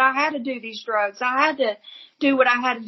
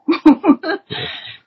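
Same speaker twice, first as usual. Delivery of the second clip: laugh; 6 semitones higher, 7 dB louder than usual. Real, not acted. Woman recalling a stunning moment, laughing. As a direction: let a laugh slip out.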